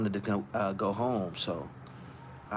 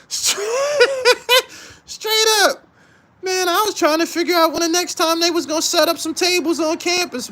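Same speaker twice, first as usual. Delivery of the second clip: laughing; 14 semitones higher than usual. Real, not acted. Still laughing at own story.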